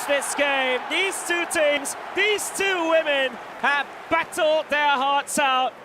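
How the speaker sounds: loud, high thrilled commentary; excited shouting. Real, not acted. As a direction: shout it over the crowd. Co-commentator after the winning goal.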